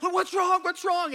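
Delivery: high, loud worried mom; alarmed wife shouting. Retold or imitated. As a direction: call out in panic.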